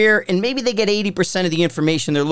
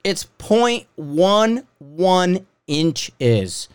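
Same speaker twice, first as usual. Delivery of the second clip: slow emphasis; much slower than usual. Shown as a demonstration. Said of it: "It's finer".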